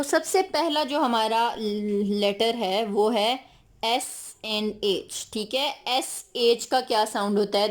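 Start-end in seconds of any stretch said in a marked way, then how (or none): none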